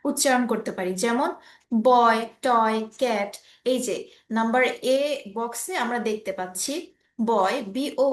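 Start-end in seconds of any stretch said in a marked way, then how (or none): 1.8-3.3 বয়, টয়, cat as single separate beats; each short word as one unbroken syllable; one-syllable demo. Shown as a demonstration. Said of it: "একবারে আমরা pronounce করতে পারি".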